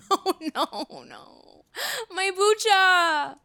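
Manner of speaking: high, crying wail over a spill; wailing in dismay. Real, not acted. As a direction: cry out in real dismay. Spilled her kombucha on table.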